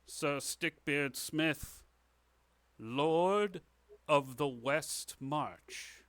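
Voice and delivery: slow ceremonial proclamation; king knighting someone. Acted. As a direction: proclaim it grandly, pausing between phrases. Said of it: "holds out his sword and he says".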